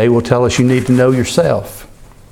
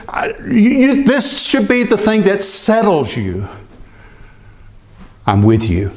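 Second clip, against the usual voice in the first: voice of the Lord; 9 semitones higher than usual. Acted. God reassuring Moses, high and earnest. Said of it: "He says".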